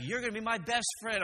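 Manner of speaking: high-pitched mock-friendly menace; drill instructor voice. Drill instructor claims the recruit as best friend, high-pitched. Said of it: "He's like".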